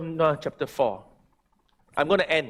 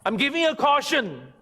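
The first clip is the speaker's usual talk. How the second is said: forceful preaching; 8 semitones higher than usual. Acted. Pastor warning the church against stubborn anger, forceful preaching. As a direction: preach it hard, a stern warning.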